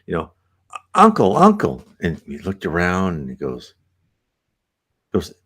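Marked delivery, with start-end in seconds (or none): 1.0-1.9 put-on higher, louder child calling "Uncle, uncle."; little girl voice. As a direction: call out like a small girl to her uncle. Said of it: "he heard her as a little girl voice".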